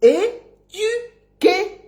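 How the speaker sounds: high, very slow "é-du-quer" for "éduquer"; stretched syllables with rounded "u" in "éduquer"; correct pronunciation. Shown as a demonstration. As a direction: teach "éduquer" sound by sound. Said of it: "En bon français".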